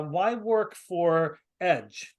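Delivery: ordinary talk, nothing marked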